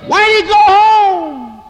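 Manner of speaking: long, high, drawn-out holler; yelling. Acted. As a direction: holler it far, stretching out the last word. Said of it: "get to yelling, yelling".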